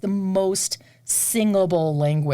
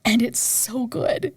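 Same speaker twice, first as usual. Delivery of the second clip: slow, hushed and awed; whispered rapture; 6 semitones higher, slower than usual. Real, not acted. Singer gushing to interviewer about Ravel opera, awed whisper. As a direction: breathe it out in awe.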